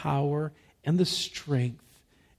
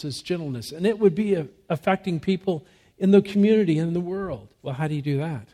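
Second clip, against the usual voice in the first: ordinary delivery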